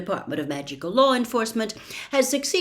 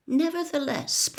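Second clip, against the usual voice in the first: ordinary delivery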